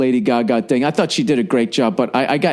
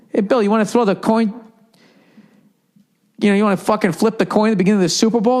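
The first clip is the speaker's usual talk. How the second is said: mock request; 8 semitones higher, faster than usual. Imagined request to the old man.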